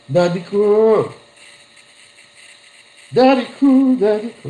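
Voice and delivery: high, doting "Daddy ko!" repeated; affectionate calling. Shown as a demonstration. Preacher imitating a sweet wife or child calling daddy, doting. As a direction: coo "Daddy ko" like a clingy child. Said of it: "mga malalambing".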